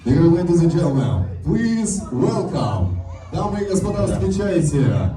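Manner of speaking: drawn-out ringmaster announcement. Acted. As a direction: stretch it out, call the couple in. Host announces couple's entrance. (торжественно, как конферансье цирка)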